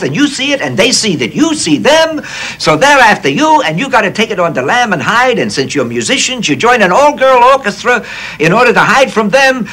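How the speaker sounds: high mock Austrian-German accent; Billy Wilder's accent. Lemmon imitating Wilder's Austrian-German accent pitching the film plot. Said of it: "Katzenjammer Kids accent".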